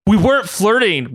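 high-pitched, defensive protest; indignant denial. Acted. Ryan and Logan deny flirting in unison, indignant. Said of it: "said in unison".

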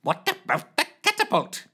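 high eager interjection; Carl Shorten voice. Acted. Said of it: "interjected Carl Shorten".

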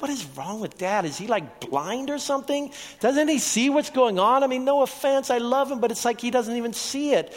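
mock your younger self baffled by Dad; incredulous teenager. Teen self mocking father's faith.

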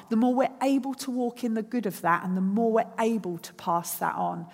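normal talking voice

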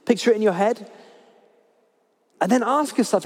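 ordinary delivery

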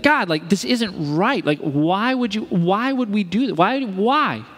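high, pleading mock protest; wrestling with God. Imitating father asking God why, frustrated protest. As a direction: press God for an answer, exasperated. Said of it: "Wrestles with God".

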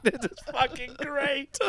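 high, slow, laughing through the words; laughing delight. Real, not acted. Fan watching Ishii snub Taguchi, laughing with delight. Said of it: "And you're like".

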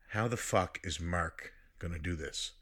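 low, soft, worried thought; hushed dread. Retold or imitated. Player worrying for his friend, hushed dread. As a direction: think it aloud, quietly stunned. Retold dawning worry for Mark.

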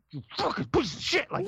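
half-hearted imitation of rage; mock angry snarl. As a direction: curse at the grill like it wronged you. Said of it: "He starts fucking scraping it like".

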